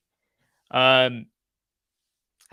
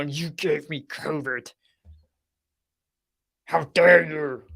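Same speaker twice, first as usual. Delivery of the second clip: mock outrage; slower than usual. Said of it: "You're gonna grab them and go".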